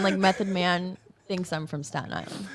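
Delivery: ordinary talk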